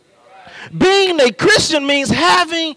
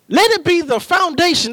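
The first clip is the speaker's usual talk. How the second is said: ordinary talk, nothing marked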